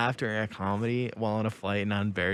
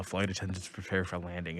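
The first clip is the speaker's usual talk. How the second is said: pilot announcement; 5 semitones lower, 7 dB quieter than usual. Retold or imitated. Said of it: "anytime he says anything".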